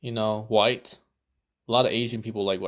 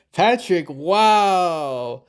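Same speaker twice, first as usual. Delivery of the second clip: louder, higher mock amazement at the name; mock surprise; 6 semitones higher, 5 dB louder, much slower than usual. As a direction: act amazed at the name, half in jest. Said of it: "I'm like".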